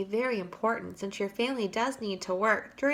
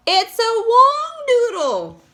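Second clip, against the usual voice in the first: slow, much higher, loud yell; 12 semitones higher, 9 dB louder, slower than usual. A narrator's reading. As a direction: yell it in alarm. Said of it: "yelled the head of the fire department".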